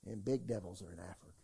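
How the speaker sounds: low, soft, fast mock-spooky voice; spooky warning. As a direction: imitate the scared warners, deep and hushed. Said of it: "everybody told me".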